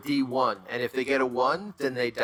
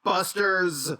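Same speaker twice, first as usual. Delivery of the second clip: hammed-up taunt; 6 semitones higher, slower than usual. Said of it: "And I say".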